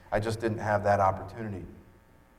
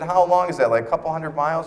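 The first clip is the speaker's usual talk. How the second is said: disbelieving; 8 semitones higher, 7 dB louder than usual. Retold or imitated. John asks how far the trip is.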